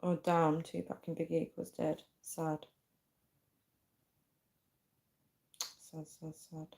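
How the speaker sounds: very soft, fading to barely audible; muttering to self. Real, not acted. Streamer reacting to [name] and [name] being dead, quiet muttering.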